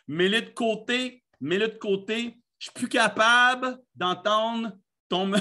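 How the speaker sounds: hammed-up exasperation, breaking toward laughter; exasperated nagging. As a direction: nag like a fed-up partner, dragging it out. Acting out fed-up partner.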